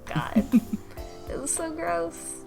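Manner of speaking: ordinary talk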